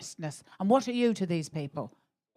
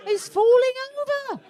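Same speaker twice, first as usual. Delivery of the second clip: ordinary talk, nothing marked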